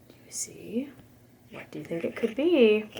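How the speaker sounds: hushed, breathy question; whisper. A narrator's reading. Storyteller whispering to the children about the red eyes, hushed. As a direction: draw the listeners in with a secret. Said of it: "storyteller whispers to listeners".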